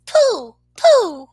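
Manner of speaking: high, scornful pooh pooh; dismissive pooh. Acted. Brave girl scoffing at the tiger, high and scornful. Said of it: "Madeline just said".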